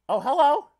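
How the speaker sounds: delighted squeal. Real, not acted. Delighted on seeing the character.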